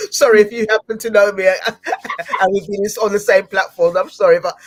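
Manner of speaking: loud, amused apology through real laughter; laughing apology. Real, not acted. Host apologising to people who know them, laughing. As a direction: apologise while cracking up. Host cracks up at own joke.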